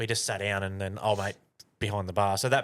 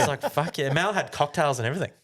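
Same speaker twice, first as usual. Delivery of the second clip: laughing; 6 semitones higher, 3 dB louder than usual. Real, not acted. Telling the free bill payoff.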